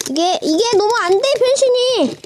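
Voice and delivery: high-pitched frustrated complaint; exasperated whine. Real, not acted. Toy reviewer struggling with a transforming toy, exasperated.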